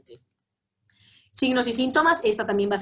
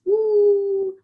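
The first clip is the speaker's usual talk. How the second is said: high surprised uh; 11 semitones higher, 6 dB louder than usual. Real, not acted. Reaction after the patient's quote.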